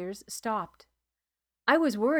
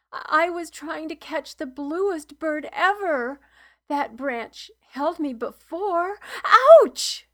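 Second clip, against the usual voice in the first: put-on higher, small boy's voice, hurt; whimpering child; 6 semitones higher, 8 dB louder than usual. A narrator's reading. Injured boy explains his fall, whimpering. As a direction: speak as a frightened hurt child. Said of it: "Torian whimpered".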